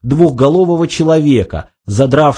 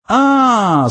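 high, drawn-out realisation; embarrassed; 10 semitones higher than usual. A narrator's reading. Zaphod finally remembers the party, embarrassed. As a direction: stretch it out as it dawns, abashed. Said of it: "смутившись".

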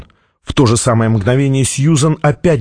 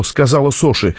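usual speaking voice

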